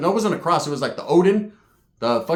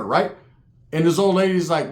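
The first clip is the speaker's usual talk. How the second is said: ordinary delivery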